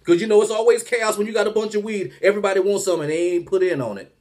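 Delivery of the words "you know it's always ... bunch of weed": usual speaking voice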